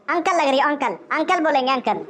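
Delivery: ordinary delivery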